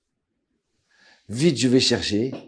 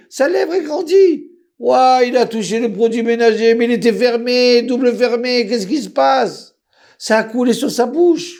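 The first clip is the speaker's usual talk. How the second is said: high, louder frantic cries; panicked wife; 9 semitones higher, 3 dB louder than usual. Retold or imitated. Wife panics to her husband over their son's burned lip, high frantic cries. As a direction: sound the alarm about the child.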